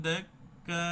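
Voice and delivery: দেক্কা with the ক্ক stopped tight; held kaf. Shown as a demonstration. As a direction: stop the air on ক্ক in দেক্কা. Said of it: "এই যে আটকায় দেব".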